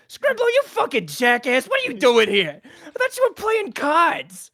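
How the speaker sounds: high, loud scolding goblin; angry goblin boss. Acted. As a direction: bawl out your bungling underling. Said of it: "the boss is like".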